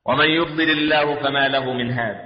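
low, slow chanted verse; Quranic recitation. Acted. Preacher reciting on guidance, low slow chant.

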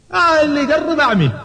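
high, stretched warning cry; acted threat. Umar holds a chief down and warns attackers off, acted threat.

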